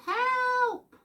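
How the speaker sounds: very high cry for help. A narrator's reading. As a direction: squeal it out in storybook panic. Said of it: "the other one cried".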